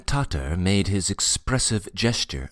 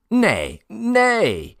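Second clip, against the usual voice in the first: loud denial leaping high; emphatic; 6 dB louder than usual. A narrator's reading. Tatar rejecting talk of madness, loud and emphatic. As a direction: deny it forcefully, second word far higher. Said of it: "the Tatar answered emphatically".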